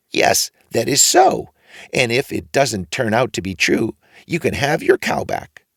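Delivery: put-on odd old man approving the boy. A narrator's reading. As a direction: draw it out knowingly.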